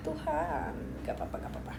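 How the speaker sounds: whispered reassurance to herself. Real, not acted. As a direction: breathe it out without voice. Whispering to herself after mishap.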